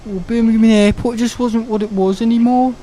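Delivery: high Brummie moaning voice; mock Birmingham accent. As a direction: grumble in an exaggerated Birmingham accent. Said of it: "I don't like that accent".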